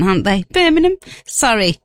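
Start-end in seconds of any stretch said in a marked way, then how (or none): 0.5-1.0 one word in a mock Brummie accent, rising at the end; a light Birmingham accent. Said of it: "They've got such a Gunda accent".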